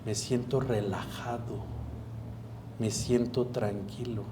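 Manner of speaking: "Me siento relajado" eased out slowly and softly; slow soft calm self-talk. Shown as a demonstration. Patient trying the new words, slow, soft and calm. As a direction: let each "siento" settle, slow and hushed. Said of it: "Cambia la palabra".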